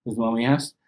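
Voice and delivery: usual speaking voice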